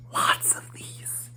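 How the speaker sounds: breathy whispered aside, slow; a slow, hushed whisper. A narrator's reading. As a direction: drop to a secret whisper. Whispered aside in story narration.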